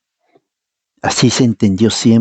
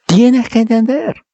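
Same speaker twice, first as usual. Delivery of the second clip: emphatic preaching cry; 12 semitones higher than usual. Real, not acted. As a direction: shake them awake with it. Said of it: "tengo que zamacarte la cabeza".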